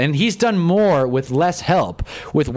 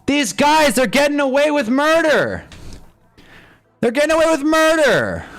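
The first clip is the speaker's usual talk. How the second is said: retold rant; 9 semitones higher than usual. Streamer repeating what he said in his video, hammed-up outrage. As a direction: replay the outraged line from the video. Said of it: "I'm like".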